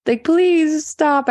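fed-up mom half-laughing, begging; exasperated plea. Retold or imitated. Mom pleading with sassy two-year-old, exasperated and high. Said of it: "And I'm just like, "Oh my gosh".

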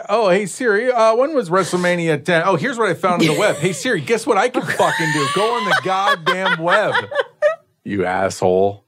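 high mocking robot-ish voice; mock the assistant, exasperated; mocking Siri. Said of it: "Siri's like".

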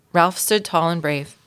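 usual speaking voice

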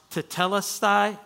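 tetelestai said clearly as one word; pronunciation demo. Shown as a demonstration. Preacher shows the congregation a Greek word, pronouncing tetelestai clearly. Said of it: "It's pronounced".

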